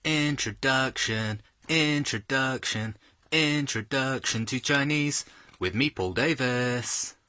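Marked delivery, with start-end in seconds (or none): 0.0-5.2 slow, drawn-out mock announcer voice; announcer intro. Host opening his own show with a grand slow title.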